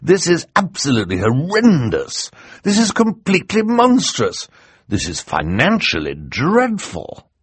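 loud, emphatic denunciation; indignant outrage. Acted. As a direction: pile up the protests, slowing on the last. Outraged guardian protests the wedding.

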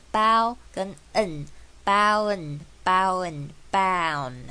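包 and n said apart, then blended into bound; 拆开再连起来. Shown as a demonstration. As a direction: build "bound" from 包 plus n, piece by piece. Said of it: "自己拆开再慢慢连起来". (包、n拆开，再慢慢连成bound)

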